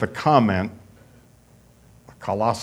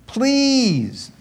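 put-on begging boyfriend; 13 semitones higher, 4 dB louder than usual. Boy begging for a kiss.

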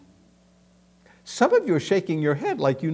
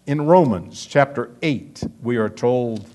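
ordinary delivery

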